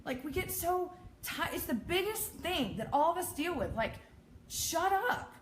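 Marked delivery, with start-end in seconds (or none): 3.7-5.3 high, exasperated shut up; mean dismissal. Real, not acted. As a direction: shut them down, pitched up. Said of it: "That was so mean".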